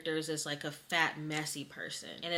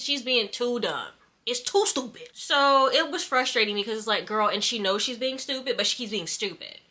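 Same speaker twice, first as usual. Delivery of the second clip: raised, louder and higher, fed up; real exasperated yelling; 4 semitones higher, 6 dB louder than usual. Real, not acted. Reader ranting to viewers about a stupid main character, yelling. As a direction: let frustration push the voice loud. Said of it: "I feel like I'm yelling".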